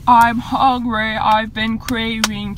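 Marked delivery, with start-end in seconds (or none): 0.0-1.1 slow, drawn-out whine; whining i'm hungry; whiny hungry. Real, not acted. Whining about being hungry.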